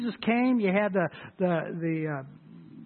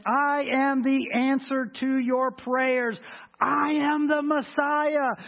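loud proclaiming; 7 semitones higher, 4 dB louder, slower than usual. Acted. Quoting Jesus' claim, preaching.

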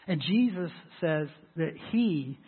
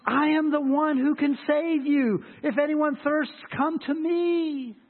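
loud proclaiming; 8 semitones higher, 4 dB louder, slower than usual. Acted. Preacher voices Jesus' invitation.

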